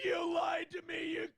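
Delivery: high, loud mock yell; imitating a furious rant; mocking angry shout. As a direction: shriek it back like he's losing it. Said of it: "he got all mad".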